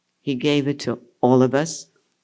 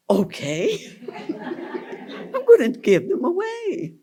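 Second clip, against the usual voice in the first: high, giggling agreement; laughing as she gives in; 13 semitones higher than usual. Real, not acted. Laughing at giving photos away.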